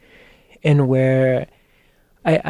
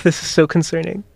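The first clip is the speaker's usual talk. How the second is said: usual speaking voice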